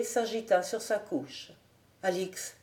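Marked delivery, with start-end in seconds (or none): 2.0-2.6 soft sleepy murmur of a name. A narrator's reading. Dreaming captain murmurs a loved one's name, softly. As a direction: breathe the name half asleep. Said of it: "murmura-t-il".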